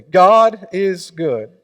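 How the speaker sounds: slow, loud call to the congregation; call and response. Acted. Preacher leading the congregation in a loud call. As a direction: stretch it out for them to join. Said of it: "Say it with me".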